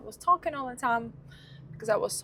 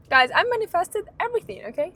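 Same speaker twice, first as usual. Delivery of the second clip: excited; 9 semitones higher, 4 dB louder, much faster than usual. Real, not acted. Excited boast to camera.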